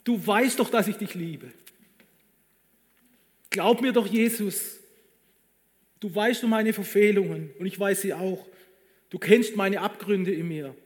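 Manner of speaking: high, loud, pleading cry to his master; desperate outcry. Acted. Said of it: "Vielleicht hat er sogar rausgeschrien".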